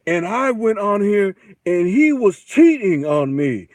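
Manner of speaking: loud, high, drawn-out mock complaint; hammed-up wail of a cheated-on guest; scripted talk-show guest. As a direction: overact an ignorant-sounding TV guest, slow and blaring. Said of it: "they make 'em sound so like".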